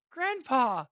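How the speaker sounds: loud, high joyful cry; lighting up. Acted. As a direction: call out delightedly to your grandfather. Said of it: "He immediately lights up".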